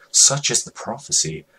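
ordinary delivery, nothing marked

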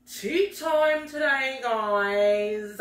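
lightly put-on lady voice announcing tea time. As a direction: draw out the show's title like a fancy hostess. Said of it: "we come back with the voice today".

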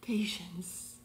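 hushed, voiceless whisper; barely-there whispered word. Real, not acted. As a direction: whisper it very softly. Whispered word after laughing fit.

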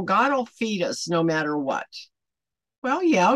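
ordinary talk, nothing marked

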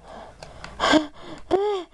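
sharp intake of breath; startled gasp. Real, not acted. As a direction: gasp as if caught off guard. Startled during the game.

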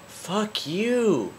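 high, drawn-out exasperated cry; frustrated cursing. Real, not acted. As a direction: curse the game out, pitch leaping up. Gamer cursing at the game.

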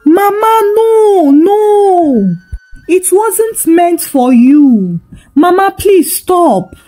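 high tearful cries of panic, pitch well up; crying shout. A narrator's reading. Izu crying out to his mother in panic, high tearful shout. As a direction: wail it out, a young man's voice breaking in dread. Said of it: "He shouted".

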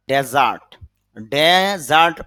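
ডেজার্ট opened with "de-", as in "DE-zart"; noun pronunciation. Shown as a demonstration. Teacher giving the noun form for learners, carefully pronounced. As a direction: say ডেজার্ট with an open e in the first syllable. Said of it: "উচ্চারণ হবে যখন এটা noun".

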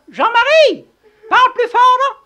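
loud high call. Acted. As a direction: shout so he hears. Calling to deaf man. (appeler fort)

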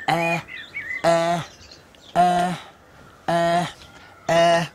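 short eh sound "Eh" said again and again; repeated eh sound. Shown as a demonstration. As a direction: sound out "eh" like searching. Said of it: "begins with eh".

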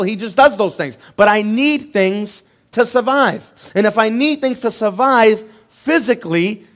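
loud, rising sermon delivery; full-force preaching. Real, not acted. Pastor to congregation, loud preaching.

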